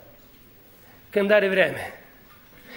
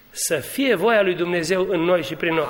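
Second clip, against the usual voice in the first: ordinary talk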